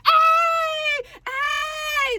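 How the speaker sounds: high, drawn-out mock cheer; high-pitched party whoop. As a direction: imitate a hyped crowd yelling. Party guests cheering with no music.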